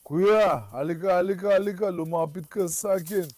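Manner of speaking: soft urgent call to come near; hushed coaxing. Real, not acted. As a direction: wave them close without a sound. Coaxing someone closer in danger.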